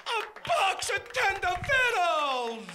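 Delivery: slow, high, loud wail of disbelief; drawn-out incredulous repeat. Acted. Comedian repeating the punchline to the crowd, drawn-out disbelief.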